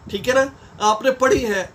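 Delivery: normal talking voice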